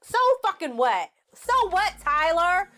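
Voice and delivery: high, indignant complaint to Tyler; exasperated rant. Real, not acted. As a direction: rant at the writer, voice climbing. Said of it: "Tyler, what the fuck".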